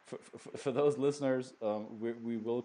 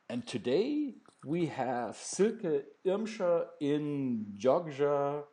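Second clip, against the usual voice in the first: announcer intro; 5 semitones higher, 4 dB louder, much slower than usual. Acted. Introduces guest on the line.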